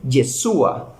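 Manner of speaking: Yeshua said slowly, stress on "shu"; Hebrew name. Shown as a demonstration. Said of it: "en hebreo se dice".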